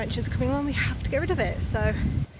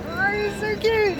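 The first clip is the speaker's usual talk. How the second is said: gushing squeal; 10 semitones higher, 4 dB louder, slower than usual. Real, not acted. Spots something cute.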